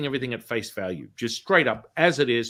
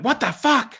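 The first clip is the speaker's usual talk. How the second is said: high, loud mock shock; imitating a startled, loud cry; shocked outburst; 12 semitones higher, 6 dB louder than usual. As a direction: exclaim it as a stunned woman. Acting her shock at healing.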